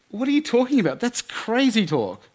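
high-pitched mock female officer; incredulous policewoman. Policewoman scoffs at boyfriend's claim.

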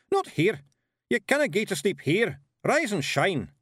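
loud, high Scottish yell; Scottish shout. A narrator's reading. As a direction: bellow it like a tiny angry Highlander. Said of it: "Rob Anybody shouted".

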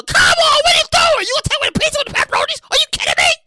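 high, loud, frantic yelling; hammed-up indignant squawk; outraged protest. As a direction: protest at the top of your range. Outraged reply to losing pizza.

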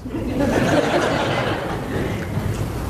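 airy high laugh, rising; breathy chuckle of enjoyment; real laughter. Real, not acted. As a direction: let a delighted laugh out.

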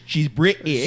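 slow put-on British accent; mock British accent. Friends joking about British teeth, slow put-on British accent. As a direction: lean into a cartoon British accent.

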